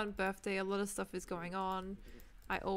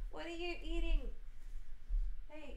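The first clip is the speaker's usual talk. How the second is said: pet talk voice; 8 semitones higher than usual. Real, not acted. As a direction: coo at a naughty pet. Questioning pet eating plastic.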